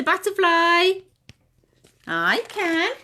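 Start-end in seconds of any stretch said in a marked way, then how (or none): none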